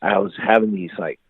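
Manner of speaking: normal talking voice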